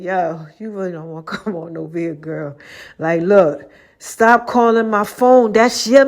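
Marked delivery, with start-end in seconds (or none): 0.0-3.7 quiet, menacing warning, much softer; low warning. Retold or imitated. Warning the caller off her man, quiet and menacing. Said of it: "And I said".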